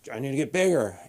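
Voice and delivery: mock strained meathead voice; straining gym guy. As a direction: push it out like an obsessed lifter. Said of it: "they have that vein in their neck".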